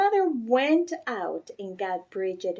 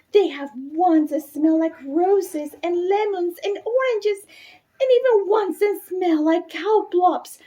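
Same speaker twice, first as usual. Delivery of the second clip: put-on excited little girl begging; 8 semitones higher than usual. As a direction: pile on the pleading until it bursts out. Bridget pleads, growing more excited.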